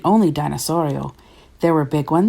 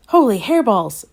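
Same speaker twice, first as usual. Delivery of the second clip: raised, louder cry of amazement; exclaiming; 5 semitones higher, 5 dB louder than usual. A narrator's reading. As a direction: blurt it out in astonishment. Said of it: "exclaimed Skippy John Jones".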